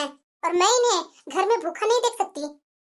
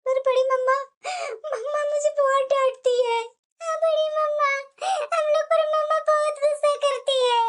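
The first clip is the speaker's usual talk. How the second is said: put-on higher whiny child's voice, near tears; complaining little girl; 4 semitones higher than usual. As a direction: tattle to auntie like a teary little girl. Daughters complain to aunt, crying.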